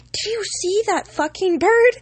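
high, loud disbelieving question to a friend; urgent amazement. Retold or imitated. Nudging friend about huge bird.

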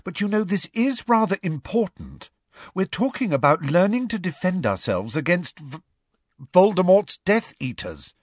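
high, urgent schoolgirl voice; earnest, impatient Hermione. Acted. Schoolgirl talking about defending themselves, urgent and high. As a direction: press how serious this is, pitched high.

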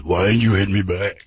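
low mock opponent's voice; imitated boxing opponent. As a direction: ask it low, as the big boxer. Quoting opponent in the ring.

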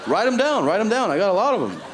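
high-pitched, eager urging; mock eagerness. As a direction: urge them on like a self-declared genius. Acts cousin boasting of many ideas.

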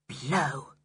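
low, soft muttered curse; under the breath. A narrator's reading. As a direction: swear softly to yourself. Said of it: "said Julian under his breath".

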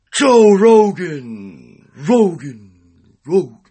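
slow drawn-out divine call; booming God voice. Acted. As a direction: call down from the heavens, slowly. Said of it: "this is God speaking".

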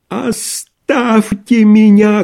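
high, drawn-out shout, beside himself; frenzied cry. A narrator's reading. Sick doctor driving visitors off, frenzied cry. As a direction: scream it slowly in a voice not your own. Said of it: "крикнул он не своим голосом".

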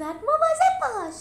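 very high, loud, drawn-out shriek; Perny shrieks from outside the ring to warn Julius; warning scream. Acted. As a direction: scream a warning across the arena. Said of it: "پرنی از بیرون جیغ زد".

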